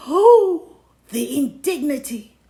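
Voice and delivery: loud, high hammed-up "Oh"; theatrical gasp of offence; mock indignation. A narrator's reading. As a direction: ham up the wounded pride. Mock outrage at losing.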